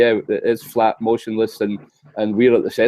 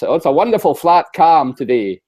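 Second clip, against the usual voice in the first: light West Highland Scottish lilt, raised; doing a West Highland local, higher; West Highland accent; 5 semitones higher, 3 dB louder than usual. Retold or imitated. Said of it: "that's me in my best West Highland accent".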